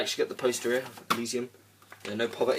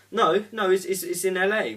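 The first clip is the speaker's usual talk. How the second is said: higher, louder mock protest from a mate; retold friend's raised correction; mate's loud protest; 8 semitones higher, 7 dB louder than usual. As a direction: fire back a quick no, no. Mate correcting him in argument.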